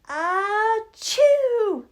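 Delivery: huge high loud sneeze; enormous sneeze. Acted. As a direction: let out a giant bear sneeze. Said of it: "gave an enormous sneeze".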